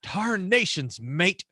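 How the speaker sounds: badly faked Aussie with a Texas twang; Texan imitating Australian. Texan showing off to his co-host, mock Australian accent. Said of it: "a Texan imitating an Australian".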